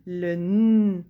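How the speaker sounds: "N" as a long hummed "nnn"; "le N" with the consonant drawn out; letter sound held. Shown as a demonstration. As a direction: hold the sound of "N", not the letter name. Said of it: "ça va faire Nel".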